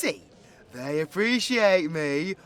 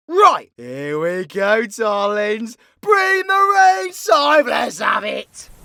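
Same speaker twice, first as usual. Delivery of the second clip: drawn-out hype shout, rising high; showman's roaring kick-off; rallying call; 3 semitones higher, 7 dB louder, slower than usual. Pretend. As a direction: build to a big slow shout to kick off. Host calls out the show's start.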